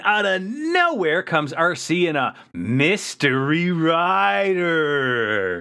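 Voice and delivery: slow, drawn-out reveal with rising pitch; mock dramatic announcement. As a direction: stretch it like a showman's big introduction. Hyped reveal of surprise racer.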